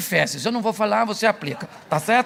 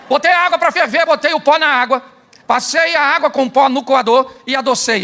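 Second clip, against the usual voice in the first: higher and louder, laughing through it; excited demo; 5 semitones higher, 6 dB louder than usual. Real, not acted. Preacher gleefully listing his coffee steps to the crowd, loud and high. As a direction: rattle off the steps with glee.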